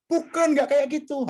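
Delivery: high, slow mock rejection from a superior; dismissive boss. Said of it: "diminta atasan".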